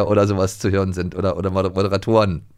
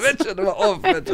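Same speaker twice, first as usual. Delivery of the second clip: mock squeaky protest.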